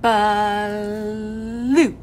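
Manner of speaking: "Blue" with the L drawn out long; slow exaggerated speech modeling. Shown as a demonstration. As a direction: stretch the L in "Blue". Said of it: "We're gonna say it like this".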